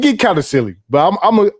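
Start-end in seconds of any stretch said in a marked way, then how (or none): none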